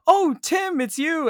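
high, loud mock Charlotte greeting; imitating Charlotte. Retelling the film, voicing Charlotte's high gushing greeting. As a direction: squeal her surprised hello way up high. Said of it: "Charlotte says".

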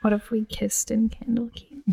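whispered flirty joke; teasing whisper. Real, not acted. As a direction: breathe it as a cheeky secret.

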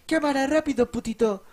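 high, loud mocking bark; hammed-up angry teacher snapping orders. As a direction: imitate a furious teacher ordering him around. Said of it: "de emputamiento".

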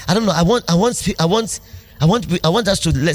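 ordinary talk

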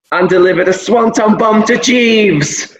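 high-pitched, amazed climax; excited, disbelieving play-by-play recap; incredulous excitement. Real, not acted. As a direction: build to the absurd punchline, pitch soaring. Climax of an absurd wrestling retelling.

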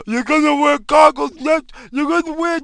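high, mushy mock voice as if chewing his tongue; mocking Fauci. Mimicking Fauci as if chewing his own tongue, mushy high mock voice. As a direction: mumble like a tongue-chewing doctor. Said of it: "chewing on his big fat fucking tongue".

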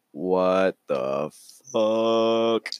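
dumbfounded, words held long; stunned disbelief. Acted. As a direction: stretch each word out in a daze. Max stunned, acted in story.

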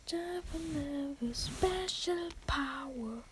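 slow dramatic whisper. Pretend. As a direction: breathe it out like a secret spell. Dramatic gaming power-up announcement.

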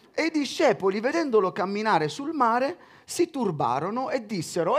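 measured Bible reading voice; scripture reading. Acted. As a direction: read the Gospel out to the congregation. Reads the Gospel aloud.

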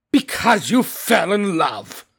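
slow, growling scornful echo; growled. A narrator's reading. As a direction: growl his words back, dragging them out. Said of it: "growled Scrooge".

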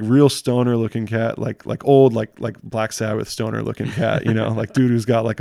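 ordinary delivery

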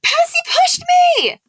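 accusing scream at full volume. Acted. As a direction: scream it out from the fountain.

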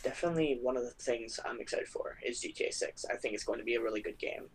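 quiet but conversational. Real, not acted. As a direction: keep the volume down for the mic. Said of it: "just a tad bit quieter".

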